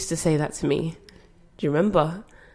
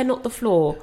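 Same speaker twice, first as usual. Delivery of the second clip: usual speaking voice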